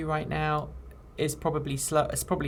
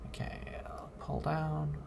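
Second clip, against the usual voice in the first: soft whisper to himself; hushed aside while working; 3 semitones lower, 11 dB quieter than usual. Real, not acted. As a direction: whisper it under your breath.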